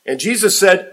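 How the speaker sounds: ordinary talk, nothing marked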